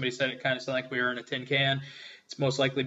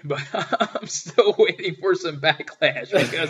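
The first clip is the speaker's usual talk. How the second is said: laughing; 3 semitones higher, 5 dB louder, slower than usual. Real, not acted. Laughing at his own gaffe.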